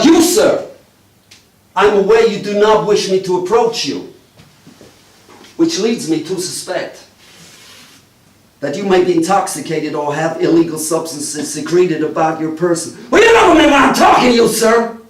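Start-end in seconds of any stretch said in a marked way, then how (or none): 0.0-0.7 sudden bark. Acted. Detective barks at audience member. 13.1-15.0 high, fast mock shout at a punter; high, fast hammed-up yell; sudden shout. Detective yells at audience member.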